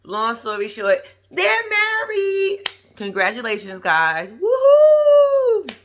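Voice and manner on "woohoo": high, loud whoop; joyful high whoop; cheering. Real, not acted. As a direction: celebrate them out loud. Cheering the newlyweds.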